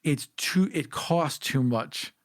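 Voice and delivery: normal talking voice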